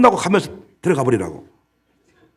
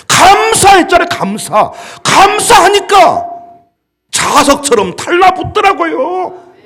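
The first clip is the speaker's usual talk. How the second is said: loud, high, drawn-out pulpit cry; shouted sermon climax; fiery preaching; 13 semitones higher, 10 dB louder than usual. Acted. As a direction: preach it with full fervor. Preaching at full force.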